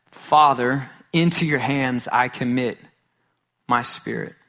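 ordinary talk

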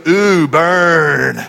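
teasing laugh. Retold or imitated. Friend teases with a laugh.

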